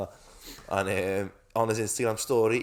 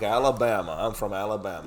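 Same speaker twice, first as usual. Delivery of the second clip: put-on lightly done American South accent saying Alabama; Southern American accent; 4 dB louder than usual. A light Southern US accent quoting the singer to a friend. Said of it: "a deep American South accent".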